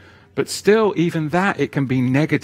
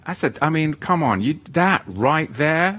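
ordinary talk, nothing marked

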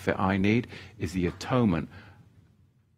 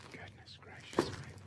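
whisper; 13 dB quieter than usual. Real, not acted. Preacher overcome, whispering to himself.

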